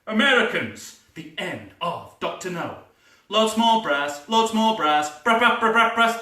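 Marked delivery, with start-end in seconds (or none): 3.3-6.2 excited, rising call for more brass; brass fanfare demand. Acted. Composer pushing the orchestra, excited. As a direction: urge the band louder.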